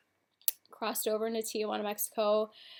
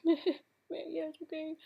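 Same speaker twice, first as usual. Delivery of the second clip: high, put-on breezy voice; mock calm; 8 semitones higher, much slower than usual. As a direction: pretend you were totally fine, squeaky and false. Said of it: "it wasn't that calm".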